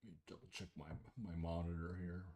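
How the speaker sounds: ordinary talk, nothing marked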